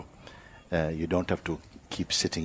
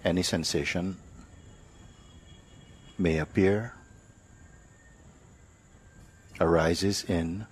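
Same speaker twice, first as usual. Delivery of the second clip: guided meditation; 3 dB quieter than usual. Real, not acted. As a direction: lead them unhurriedly, phrase by phrase, into awareness.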